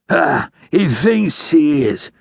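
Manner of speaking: slow, high, slurred answer; labored speech. A narrator's reading. Dying outlaw answering the ranger, words labored. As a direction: struggle to form each word. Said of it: "sounded more difficult for him to form his words".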